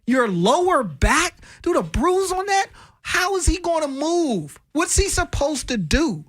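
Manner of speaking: high-pitched exasperated questioning; high, incredulous protest without raising the volume. Real, not acted. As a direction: plead the case in disbelief, pitch way up. Argues the injury was serious.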